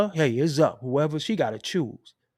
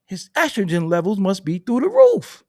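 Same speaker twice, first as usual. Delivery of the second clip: mock outrage; 4 semitones higher, 6 dB louder than usual. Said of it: "I'm like".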